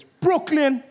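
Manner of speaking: high, loud random shout. Retold or imitated. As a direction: yell it out across an airport. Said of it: "shouting random things".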